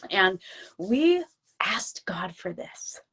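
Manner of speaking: slow hushed whisper; reverent whisper. Real, not acted. Whispering to her host about what they asked God for. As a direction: breathe it out slowly, like a secret prayer.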